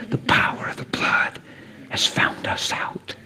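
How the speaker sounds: voiceless, awed whisper; hushed whisper. Acted. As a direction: breathe it in a reverent whisper. Hushed awe in the sermon.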